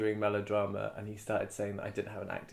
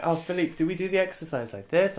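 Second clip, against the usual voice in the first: higher, a bit louder, timid student; mock student voice; 8 semitones higher, 6 dB louder than usual. Actor imitating himself asking Philippe, timid. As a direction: ask it meekly like a nervous student. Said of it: "I'd be like".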